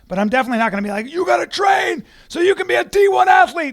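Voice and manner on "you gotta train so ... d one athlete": mock pushy sports dad. Mocking a pushy sports parent.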